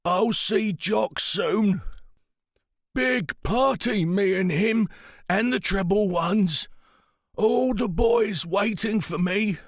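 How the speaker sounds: put-on old pilot drifting toward death; frail old man, far away. A narrator's reading. Pritch on dying, dead comrades.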